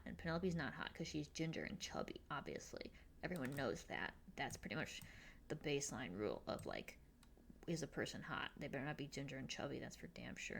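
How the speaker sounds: snide. Retold or imitated. Mean girls voiced mocking Penelope.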